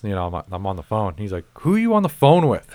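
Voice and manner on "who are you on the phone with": high, loud mocking coworker voice; nosy coworker. Coworker butting in on a phone call, high and loud.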